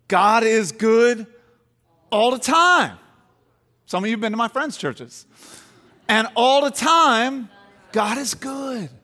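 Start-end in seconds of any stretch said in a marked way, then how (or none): 0.1-3.0 loud, slow and lifted "God is good"; church call-out. Retold or imitated. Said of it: "will say it this way". 6.1-8.9 loud, slow call and answer; church response. Retold or imitated. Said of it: "will say it this way".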